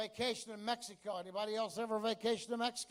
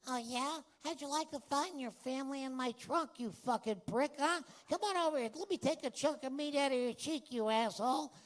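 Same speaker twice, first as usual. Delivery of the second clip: higher, tough wiseguy voice; Joe Pesci impression; 5 semitones higher than usual. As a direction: snarl it like a Pesci gangster. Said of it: "Pesci, he was hysterical".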